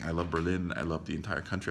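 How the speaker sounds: usual speaking voice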